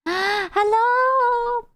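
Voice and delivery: high gasp into hello. Acted. Streamer gasps hello at the new model. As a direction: gasp as if startled.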